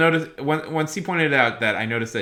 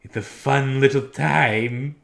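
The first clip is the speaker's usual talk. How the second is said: slow, louder, higher put-on voice; impersonation; 5 semitones higher, 7 dB louder, slower than usual. Shown as a demonstration. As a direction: draw out the words like a show host. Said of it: "try and do impersonations".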